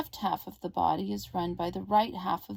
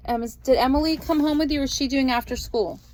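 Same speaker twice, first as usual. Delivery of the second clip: raised voice, fast and high; calling across the room; 6 semitones higher, 7 dB louder than usual. Real, not acted. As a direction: call the question across the house. Asks niece across the room.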